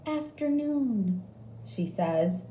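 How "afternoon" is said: high prompt; nurse voice. A narrator's reading. Nurse giving a word in a game, high.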